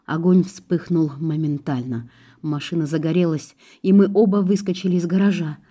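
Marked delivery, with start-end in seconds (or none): none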